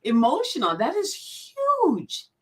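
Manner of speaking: fighting back tears on the word huge; getting emotional. Real, not acted. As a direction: voice rising high and slow, choked up with feeling. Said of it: "I'm getting emotional".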